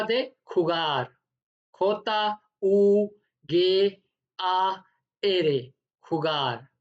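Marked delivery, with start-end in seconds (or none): 1.7-5.6 slow spelling. Shown as a demonstration.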